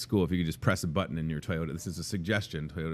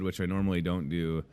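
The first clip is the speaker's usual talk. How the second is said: ordinary talk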